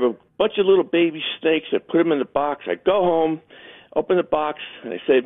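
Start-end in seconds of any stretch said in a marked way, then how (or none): none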